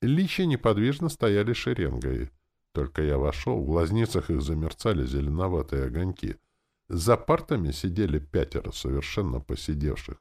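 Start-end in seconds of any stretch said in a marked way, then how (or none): none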